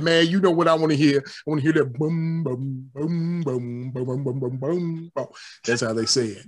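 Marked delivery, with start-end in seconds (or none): 0.0-5.3 put-on old gangster voice. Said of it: "the sixty-year-old gangster ... be like".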